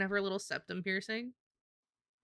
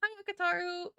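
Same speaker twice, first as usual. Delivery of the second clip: high greeting voice; 11 semitones higher, 5 dB louder than usual. Acted. Greeting a viewer.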